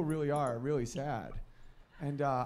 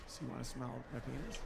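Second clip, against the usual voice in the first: hushed dog proposition; 3 semitones lower, 11 dB quieter than usual. Retold or imitated. Dog breaks the silence.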